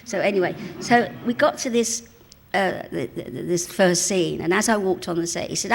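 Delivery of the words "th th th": ordinary delivery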